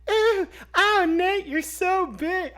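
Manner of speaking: very high, slow mock moaning mom voice; hammed-up high moaning mom; mom making noises. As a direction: ham up a mother faking sex noises, slow and high. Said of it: "their mom starts going like".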